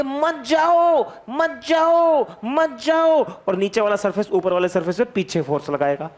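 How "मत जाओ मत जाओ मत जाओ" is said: high, long-drawn mock wail; hammed-up begging, stretched out each time; pleading molecules. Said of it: "वाले surface कहेंगे". (ऊँचे सुर में नाटकीय, खिंची हुई गुहार)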